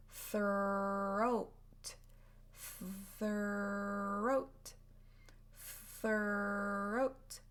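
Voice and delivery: throat stretched slow, TH into R; drawn-out throat. Shown as a demonstration. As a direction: hold throat out slowly. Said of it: "Try it slowly".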